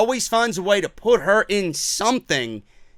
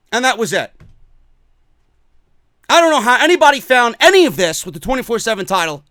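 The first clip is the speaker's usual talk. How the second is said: normal talking voice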